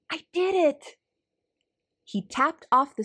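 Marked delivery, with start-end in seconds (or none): 0.1-1.0 high, bright burst of joy; triumphant. Acted. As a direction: shout out a first big win. Stanley triumphant after his number.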